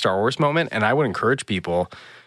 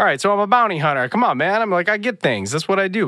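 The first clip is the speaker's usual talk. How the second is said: higher, quick, exasperated comeback; mock protesting; 10 semitones higher, 4 dB louder than usual. Scoundrel pleads his trade to Croy, protesting. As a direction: protest your case in a raised, hurried pitch.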